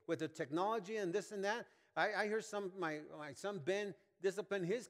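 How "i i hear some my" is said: normal talking voice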